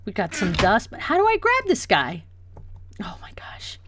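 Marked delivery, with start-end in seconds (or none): 3.0-3.8 barely-there whispered exclamation; dismayed whisper. Real, not acted. Dismayed aside, still stuck.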